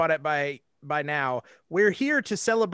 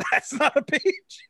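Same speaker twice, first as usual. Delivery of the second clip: laughing quote; 7 semitones higher than usual. Acted. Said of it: "somebody's like".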